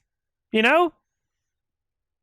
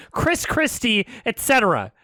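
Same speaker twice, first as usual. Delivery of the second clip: normal talking voice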